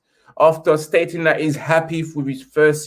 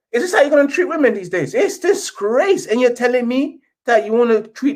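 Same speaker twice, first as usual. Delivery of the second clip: higher, outraged protest, the last word slowed; indignant rant; 7 semitones higher than usual. Real, not acted. Fan scolding the club owner, indignant. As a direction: scold the owner for the women's team.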